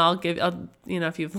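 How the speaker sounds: normal talking voice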